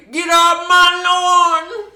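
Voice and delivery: put-on high, loud, slow old coot shout; cackling toothless ranting. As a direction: yell like a grumpy old prospector. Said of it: "like a prospector out Wild West".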